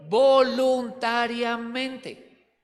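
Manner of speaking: "Voluntariamente" very slow, syllable by syllable; long, held syllables on "Voluntariamente"; stretched emphasis. Real, not acted. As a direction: draw out each syllable of "Voluntariamente". Answers own question with key word.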